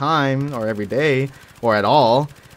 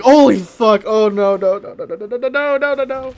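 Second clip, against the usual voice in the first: high, loud frantic no-no-no; panicked crying; 9 semitones higher, 5 dB louder than usual. Retold or imitated. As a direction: wail it out in terror on waking. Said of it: "crying like hell".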